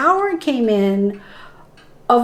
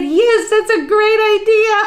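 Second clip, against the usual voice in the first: much higher, cheery answering voice; voice of God; 10 semitones higher, 4 dB louder than usual. Retold or imitated. God cheerfully answering the doubting healer, high and bright. God's reply voiced in story.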